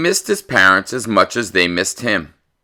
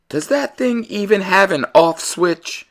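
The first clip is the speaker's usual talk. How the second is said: worried parent; 10 semitones higher than usual. Acted. Todd's parents eyeing his new computer, high and wary. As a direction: ask it high and nervous.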